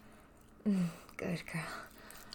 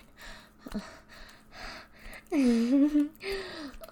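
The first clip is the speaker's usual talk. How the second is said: a long airy laugh pitched up; high whispered laugh; 8 semitones higher, 7 dB louder than usual. Acted. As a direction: laugh breathily, pleased with yourself. Possessive teasing.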